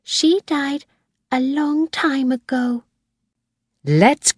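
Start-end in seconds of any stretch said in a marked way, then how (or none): 0.0-2.8 put-on high, small child's voice; little girl. A narrator's reading. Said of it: "Susan said".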